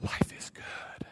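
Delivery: slow whispered sigh of contentment; dreamy hushed aside; blissful whisper. Acted. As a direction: breathe it out in bliss.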